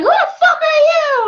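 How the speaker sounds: loud, high imitated screech; retold scream. Angry wife demanding who answered the phone, mock scream. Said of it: "she's, like, screaming".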